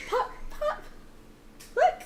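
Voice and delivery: put-on high, breathy little girl calling; a small child tugging at dad.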